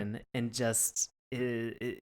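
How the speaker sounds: ordinary delivery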